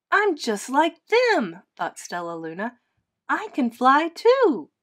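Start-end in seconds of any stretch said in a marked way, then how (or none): 0.1-1.6 put-on high, loud, slow gleeful baby bat; delighted. A narrator's reading. Baby bat flying with the birds, thrilled. 3.3-4.7 high, loud, drawn-out glee; delighted. A narrator's reading. Baby bat discovers she can fly, thrilled.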